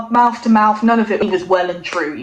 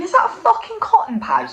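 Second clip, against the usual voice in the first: shocked disbelief; 10 semitones higher than usual. Retold or imitated. Retelling finding a pad in her gin, shocked high disbelief. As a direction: pitch jumps high in disbelief.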